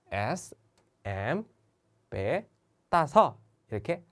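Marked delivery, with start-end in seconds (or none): 0.1-2.5 slow spelled out. Shown as a demonstration. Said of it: "그 첫 문자를".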